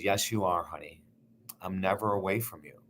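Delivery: mother reassuring her son she is near, soft; gentle reassurance. Retold or imitated. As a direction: soothe him with quiet certainty. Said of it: "She was incredibly gentle".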